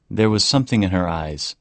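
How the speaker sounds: ordinary delivery, nothing marked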